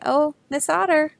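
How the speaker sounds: high, loud sing-song call; a bee hailing a friend. Acted. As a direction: call out sweetly to the otter. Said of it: "the bee is saying".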